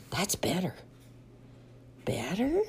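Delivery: whispered relief; whispering. Acted. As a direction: breathe it out quietly. Piggy aside after noises.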